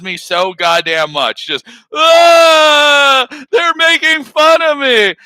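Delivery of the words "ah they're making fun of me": high, loud hammed-up wail; mock crying. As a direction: imitate someone bawling down the phone. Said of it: "whenever she called Matt and started crying".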